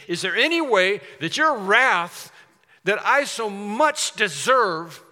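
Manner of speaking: loud, high plea, slow on deserve; urgent plea. Acted. A preacher voicing the sinner crying out to God, loud and slow. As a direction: cry out for mercy.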